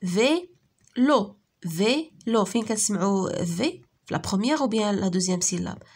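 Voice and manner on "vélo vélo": "فيلو" slowly split into vé and lo; syllable by syllable. Shown as a demonstration. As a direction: say "فيلو" as two separate beats, vé, lo. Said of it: "فيه مقطعين صوتيين".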